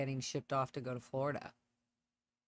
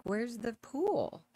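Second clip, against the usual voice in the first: slow, higher, drawn-out mock question; mock disbelief; 8 semitones higher, much slower than usual. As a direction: stretch it out in fake bewilderment. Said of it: "it's like".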